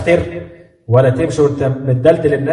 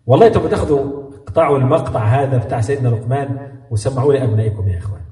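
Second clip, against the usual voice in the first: ordinary talk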